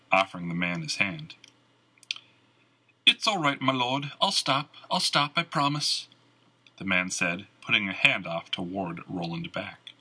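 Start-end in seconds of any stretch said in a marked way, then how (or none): 3.0-6.1 frightened bard. A narrator's reading. Frightened bard ducking for cover.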